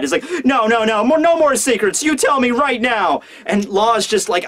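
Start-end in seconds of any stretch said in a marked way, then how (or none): 0.4-3.2 insistent demand. Acted. Luffy demanding answers from Law.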